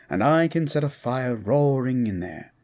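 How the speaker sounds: usual speaking voice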